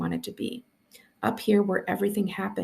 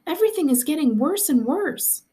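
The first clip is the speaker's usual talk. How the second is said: high, louder desperate outcry; cried out; 9 semitones higher, 4 dB louder than usual. A narrator's reading. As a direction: cry it out in alarm. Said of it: "he cried".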